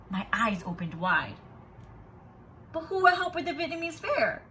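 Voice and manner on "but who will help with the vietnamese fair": eager question. A narrator's reading. Teacher asks the school.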